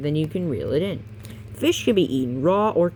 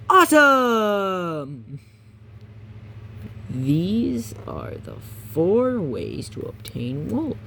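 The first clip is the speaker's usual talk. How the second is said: hammy excited; 3 semitones higher, much slower than usual. Acted. Hammed reading.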